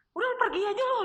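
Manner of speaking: mock harsh parent sending her away; imagined rejection. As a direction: toss it out like a cold parent.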